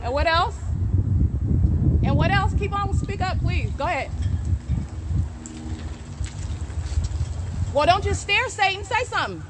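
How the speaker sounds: high, baiting call; taunting dare. Real, not acted. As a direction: bait him into speaking. Taunting Satan to speak.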